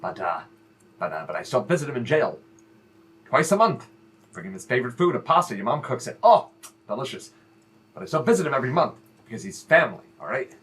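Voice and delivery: low, soft heartfelt advice; earnest fatherly talk. Acted. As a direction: speak low and sincere to your son. Father speaks earnestly about brother.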